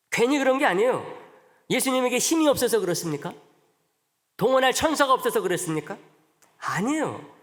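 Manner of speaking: high, loud challenging questions; preacher's emphatic rhetorical demand; forceful preaching. Acted. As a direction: press the congregation hard.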